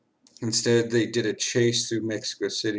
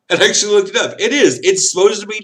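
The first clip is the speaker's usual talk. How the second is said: laughing; 9 semitones higher, 7 dB louder, faster than usual. Real, not acted. Laughing, tipsy.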